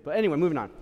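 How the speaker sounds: ordinary delivery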